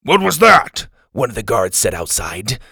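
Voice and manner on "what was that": loud and high call; loud alarmed guard. Acted. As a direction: call out suspiciously. Guard hears noise outside.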